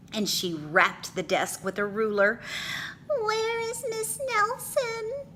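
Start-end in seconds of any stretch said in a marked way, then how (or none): 3.1-5.3 put-on very high small child voice; worried kids. A narrator's reading. Kids asking the new teacher, timid child voice. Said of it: "asked the kids".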